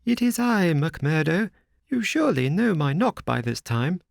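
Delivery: a nervous little host presenting the house; Thaddeus Sholto. A narrator's reading. Thaddeus answers at door.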